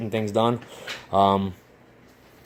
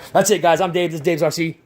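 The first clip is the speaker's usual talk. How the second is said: 高声欢快的结尾口号; 像主持人一样大声收尾; upbeat sign-off; 7 semitones higher, 4 dB louder than usual. Acted. Channel sign-off.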